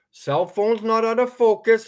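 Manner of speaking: normal talking voice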